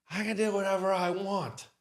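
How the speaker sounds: mock drunk defiance. Drunk guest refusing to leave.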